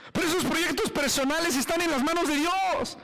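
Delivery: high, impassioned preaching; fervent declaration. Real, not acted. Preacher entrusts personal projects to God, fervent. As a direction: proclaim it with high-pitched conviction.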